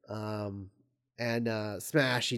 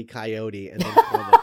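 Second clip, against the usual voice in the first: laughing; 3 semitones lower, 7 dB louder than usual. Real, not acted. Laughing at the silly line.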